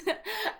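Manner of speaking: short high embarrassed laugh; a quick sheepish giggle after a blank. Real, not acted. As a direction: laugh off the slip. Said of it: "Forgot the name for a second there".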